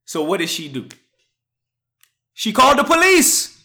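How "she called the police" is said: high, loud outraged punchline; mock outrage. Retelling the parking lot story, mock-outraged. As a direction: blurt it out in disbelief.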